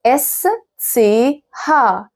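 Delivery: very slow "اس سي ها" as es, tse, ha; spelled-out German letters. Shown as a demonstration. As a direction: separate each letter of "اس سي ها". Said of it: "تاني كومبيناسيون معايا هو ال اس سي ها".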